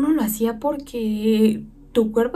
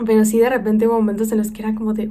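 ordinary talk, nothing marked

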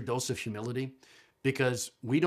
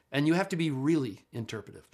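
usual speaking voice

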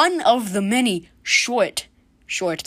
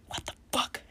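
frightened hush, barely voiced; scared whisper; 13 dB quieter than usual. Real, not acted. Podcaster hearing banging upstairs, whispering in fear. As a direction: whisper so nobody upstairs hears.

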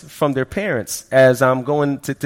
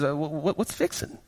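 baffled; 12 dB quieter than usual. Retold or imitated. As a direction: squeak it out, totally lost. Baffled by local slang.

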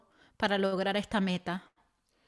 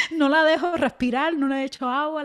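said through a laugh, louder and higher; 5 semitones higher, 6 dB louder than usual. Real, not acted. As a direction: laugh while admitting it. Laughing at own habit. (riendo mientras lo admite)

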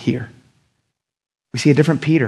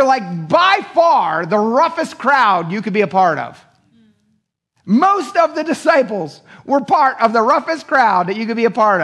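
building sermon climb; 9 semitones higher than usual. Acted. Preaching, building intensity.

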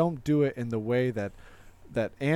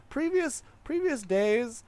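squeaky high voice; high squeak; 10 semitones higher, slower than usual. Pretend. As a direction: pitch it way up, slowly.

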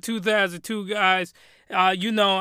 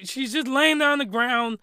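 normal talking voice

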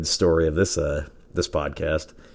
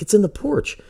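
high amazed squeal. Retold or imitated. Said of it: "I'm like".

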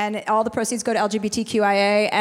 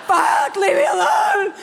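slow, high, loud shout through laughing tears; a loud, slow, half-laughing sob; laughing cry-shout; 9 semitones higher, 5 dB louder, slower than usual. Retold or imitated. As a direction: yell it while laughing and crying. Crying reply to strangers.

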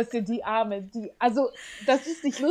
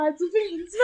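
fast and higher, breaking into laughter; laughing; 6 semitones higher, 3 dB louder, faster than usual. Real, not acted. Laughing as she tells her friend the classmate's age. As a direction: rush it out while laughing.

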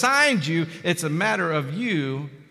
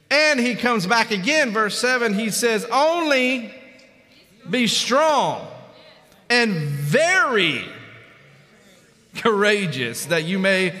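raised, emphatic pulpit scripture reading; forceful preaching; 5 semitones higher, 4 dB louder, slower than usual. Real, not acted. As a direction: proclaim the verse with weighty pauses. Preaches the verse at full force.

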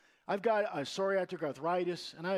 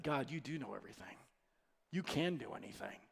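softer, hushed and overwhelmed; awed hush; 8 semitones lower, 9 dB quieter, faster than usual. Retold or imitated. Pastor recalls his frightened awe at God, softer. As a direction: speak it as if humbled before God. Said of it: "it was frightening".

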